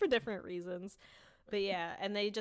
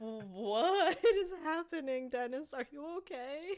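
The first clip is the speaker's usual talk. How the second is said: higher, slow, laughing disbelief; bewildered; 7 semitones higher, 3 dB louder, slower than usual. Retold or imitated. Fan reacting to Dennis's breakdown, amused alarm. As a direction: ask it half-laughing, baffled.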